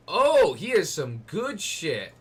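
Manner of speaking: loud, slow drawn-out delight; excited. Real, not acted. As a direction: savour the find out loud. Thrilled at finding loot.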